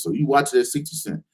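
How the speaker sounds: ordinary delivery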